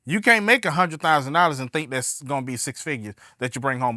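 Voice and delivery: usual speaking voice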